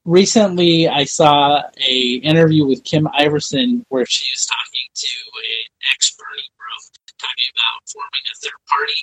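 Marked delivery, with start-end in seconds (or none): 4.1-8.9 muffled bad mic, thin sound; underwater. Real, not acted. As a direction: voice through a muted microphone. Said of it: "You sound like you're underwater".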